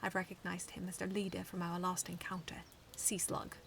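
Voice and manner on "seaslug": usual speaking voice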